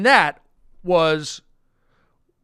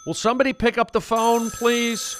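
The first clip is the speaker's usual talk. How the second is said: from another room; 7 semitones higher than usual. Acted. Mom calling from bathroom.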